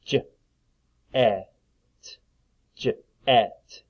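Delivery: "J-et" broken after the j; sounds split apart. Shown as a demonstration. Teacher helping pupils hear short E, "J-et" sounded out. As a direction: sound out "J-et" slowly, pausing inside. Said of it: "split the sounds apart".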